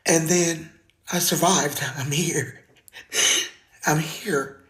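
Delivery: usual speaking voice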